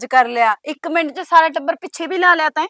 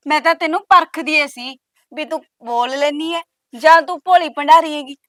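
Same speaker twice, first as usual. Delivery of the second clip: ordinary talk, nothing marked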